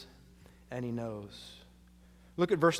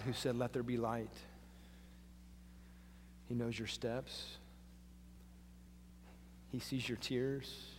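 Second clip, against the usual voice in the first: tender comforting sermon; 8 dB quieter than usual. Acted. Pastor comforting the lonely listener, soft and tender. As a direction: speak gently to someone who feels alone.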